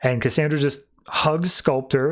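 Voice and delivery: ordinary delivery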